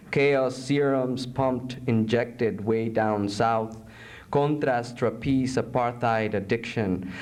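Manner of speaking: low, measured poetry reading; solemn poem narration. Acted. Poet reading a poem about torture, low and solemn. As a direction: intone the grim images evenly.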